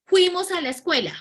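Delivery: "Fuimos" said loud and high as a sloppy example; vulgar fuimos. Shown as a demonstration. Teacher shows students a vulgar example, mock sloppy. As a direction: show the slangy way of saying "Fuimos". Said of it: "Entonces dice".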